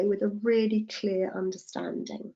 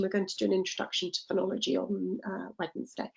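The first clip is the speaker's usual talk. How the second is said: ordinary delivery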